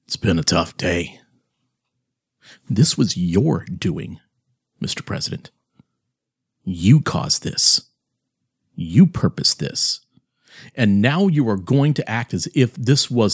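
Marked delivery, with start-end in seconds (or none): 0.1-1.2 low flat mocking quote; deadpan echo of the quote; monotone voice. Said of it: "you are going to say". 2.7-10.0 low, slow and deliberate; slow grave accusation. Acted. Accusing the president directly.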